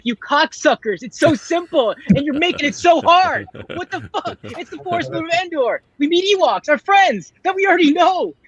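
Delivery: high-pitched comic exasperation; exasperated rant. Retold or imitated. Acts out exasperated fan reaction.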